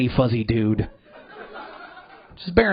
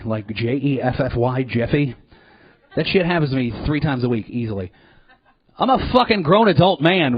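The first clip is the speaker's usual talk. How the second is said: normal talking voice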